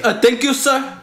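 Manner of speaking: a cartoonish Indian accent thanking a customer; bad Indian accent impression. Podcast host mocks an Indian cashier in a high Indian accent. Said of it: "That was a very bad Indian impression".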